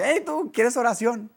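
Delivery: high-pitched call of "hey you"; retold yell. Friend calling to a stranger, high mock yell. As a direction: shout out an offer of prayer. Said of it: "Le dice".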